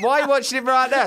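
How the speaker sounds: put-on high, fast girlish call; girls calling up. Netball girls quizzing the stuck boy, high and quick. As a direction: tease him like a schoolgirl. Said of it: "they're going".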